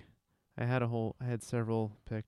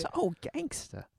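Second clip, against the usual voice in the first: mock gangsta; 7 semitones higher, 4 dB quieter, slower than usual. Echoing gangsta remark.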